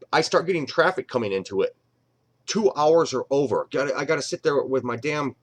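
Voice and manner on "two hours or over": Two drawn out long and loud; stress on two. Shown as a demonstration. Repeating the key number for Basher, slow and stressed. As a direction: lean hard on two, slowly. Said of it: "like, two hours or over".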